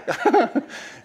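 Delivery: laugh. Real, not acted. Laughs at own voicing.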